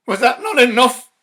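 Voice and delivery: very loud, high outburst; fierce demand. Acted. Husband demands of his wife, very loud and fierce. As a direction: hurl it at her in horror. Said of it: "he demanded fiercely".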